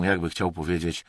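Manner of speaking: plain reading voice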